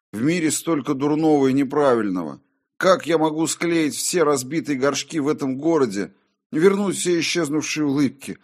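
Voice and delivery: high, loud despairing protest; impassioned, high-pitched lament; desperate outburst. A narrator's reading. Despairing answer to the voice.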